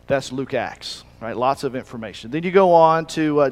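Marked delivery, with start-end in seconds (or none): none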